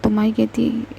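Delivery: usual speaking voice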